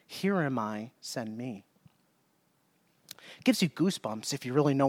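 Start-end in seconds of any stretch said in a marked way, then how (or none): 0.1-1.6 low, soft, humble reply; quiet, lowered voice of surrender; hushed answer. Acted. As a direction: drop away softly, offering yourself. Voicing Isaiah's humble answer to God.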